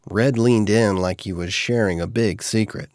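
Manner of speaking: ordinary delivery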